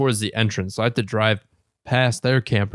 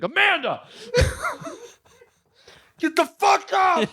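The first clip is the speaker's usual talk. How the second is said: high mock shout, laughing through it; imitating the angry brother. Mocking the brother yelling at his sister, laughing. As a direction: yell it like the annoyed brother. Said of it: "He's like".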